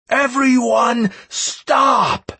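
high, loud, drawn-out halt order; commanding shout. A narrator's reading. Leader halting the deserters, commanding shout. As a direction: bellow a slow, high command to freeze. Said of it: "capable of raising my voice quite like that".

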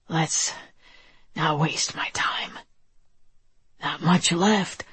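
faint dying whisper; weak whisper. Acted. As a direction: barely breathe the words out. Dying man speaks weakly.

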